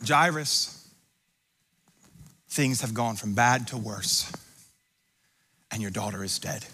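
low, soft delivery, falling away; grave bad news. Messenger telling Jairus his daughter is dead, grave and hushed. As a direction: break terrible news quietly and low. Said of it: "the word comes".

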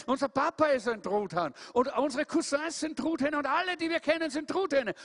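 hoch und nachdrücklich aufzählend; Truthahn, der geduldig den Bruder belehrt; belehrender Truthahn. Acted. Hieronymus zählt hoch auf. (Hieronymus belehrt Tom, hoch und eindringlich)

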